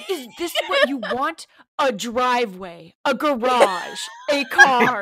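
mock-indignant list building into a laugh; mocking rant. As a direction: rattle off the list scornfully. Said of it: "I was like".

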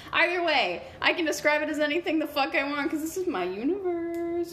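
high, playful self-important declaration; mock-grandiose. Game master jokingly asserts power over world.

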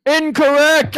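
shouted Incorrect, buzzer style; loud game show host. Acted. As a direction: bellow it like a quiz host. Game show host rejecting answer.

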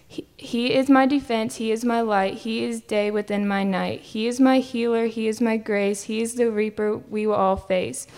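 usual speaking voice

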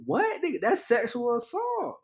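sharp high shocked question; outraged disbelief. Retold or imitated. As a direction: shoot the pitch up in disbelief. Outraged mock reaction.

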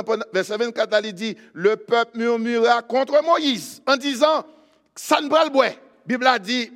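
high, loud, insistent sermon; full-force preaching. Acted. Preacher telling how Moses cried to God, full-force preaching. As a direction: drive the Bible story home to the congregation.

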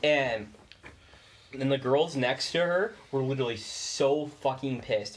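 normal talking voice

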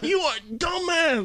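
high, slow mocking insult. Friend cursing at her, high mock insult. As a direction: sneer it like she did. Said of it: "she really cursed at me".

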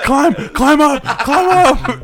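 loud high shouted command, slowing on the first climb; yelled order. Acted. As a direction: bellow it up the building like a movie thug. Acted movie quote, yelling.

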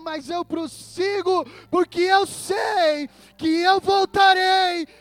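high, slow, building proclamation; triumphant declaration. Acted. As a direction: stretch each phrase as a sermon climax. Sower's declaration, preached at climax. (declaração triunfante, voz alta e arrastada)

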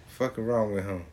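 usual speaking voice

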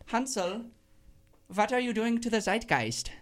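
high-pitched put-on German accent. Shown as a demonstration. Host proving he can do German, calling Hansel, German accent. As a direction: lean into a storybook German accent. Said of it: "say it with a German accent".

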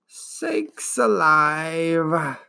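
slow, dragging, wheezing ancient voice; wheezing old turtle. Acted. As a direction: drawl it out like a weary old beast. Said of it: "said Morla".

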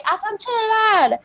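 high, slow quoted answer; quoted reply pitched well up; imitating an interviewee. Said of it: "and the person say".

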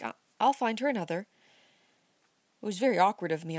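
plain reading voice

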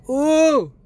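high, loud delighted squeal; excited ooh. Real, not acted. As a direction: yelp with delight. Tasting the great sauce.

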